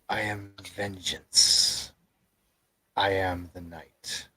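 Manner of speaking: slow, lightly voiced gruff vigilante; Batman. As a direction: draw it out as a solemn, gruff declaration. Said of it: "in a deep, gruff voice".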